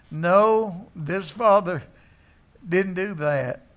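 ordinary delivery

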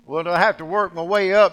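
loud, high pleading question; voiced question. Moses asking God, loud high question. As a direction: ask God loudly for a shortcut.

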